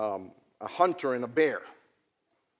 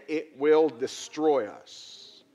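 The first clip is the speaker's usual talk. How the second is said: very slow, weighty; slow emphatic; much slower than usual. Acted. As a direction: stretch each word out slowly. Repeated for emphasis.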